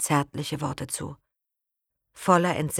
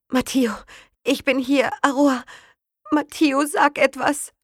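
higher, pleading, on the edge of panic; desperate plea; 9 semitones higher, 5 dB louder than usual. Acted. Aroa begging injured Matteo to speak, frightened plea. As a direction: beg him to answer.

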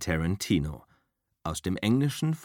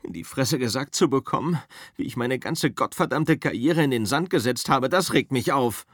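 higher, louder bitter actor's rant; Rick's bitter rant; 6 semitones higher, 4 dB louder than usual. Acted. Rick complains to his driver, higher and louder. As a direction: rant about your ruined career.

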